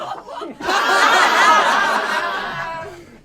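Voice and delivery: loud hearty dad laugh; big laugh. Acted. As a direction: burst out laughing. Dad laughs at teen jab.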